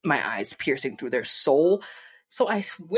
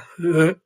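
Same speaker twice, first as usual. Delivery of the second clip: laugh; 7 dB louder than usual. Real, not acted. Laugh after disturbing memory.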